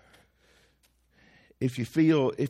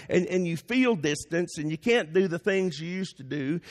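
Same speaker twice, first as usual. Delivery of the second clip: ordinary talk, nothing marked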